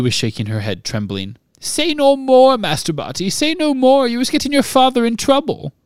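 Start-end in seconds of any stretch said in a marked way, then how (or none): 1.6-5.7 very high squeaky house-elf wail; frantic pleading. A narrator's reading. Winky begs Master Barty to say no more, frantic pleading. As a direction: beg desperately in a piercing little voice.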